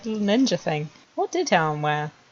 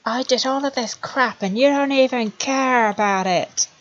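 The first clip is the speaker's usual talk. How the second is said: mock angry complaint; 6 semitones higher than usual. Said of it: "He's like".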